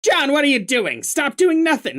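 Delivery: a bad but committed Wallace Shawn; Wallace Shawn impression. Shown as a demonstration. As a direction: imitate the little man from the movie. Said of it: "I changed your voice to inconceivable guy".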